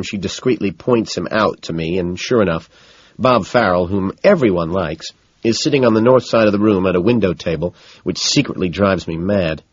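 ordinary delivery

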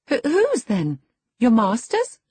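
put-on high, loud girlish questioning. A narrator's reading. Girl asking a sullen lad whose master, puzzled high voice. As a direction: ask it in a high, puzzled girl's voice.

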